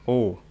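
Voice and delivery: O with lips drawn slightly tight; close, rounded "O"; bassy rounded O. Shown as a demonstration. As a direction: say "O" like Indonesian O but bassier. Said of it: "ada ngebasnya gitu".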